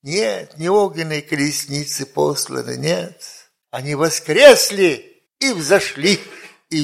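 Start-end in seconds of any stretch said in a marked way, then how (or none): none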